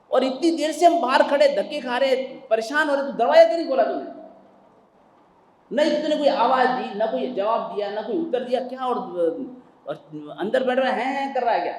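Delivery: loud, high scolding rant; angry father. Acted. Father yelling at the son who left them outside, loud angry scolding. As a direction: tear into the son for ignoring the door.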